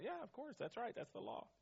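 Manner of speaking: usual speaking voice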